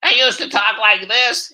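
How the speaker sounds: older take on a cartoon politician, high and loud; loud, high caricature politician voice; Adam Schiff. Shown as a demonstration. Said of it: "actually really changed over the years is Adam Schiff".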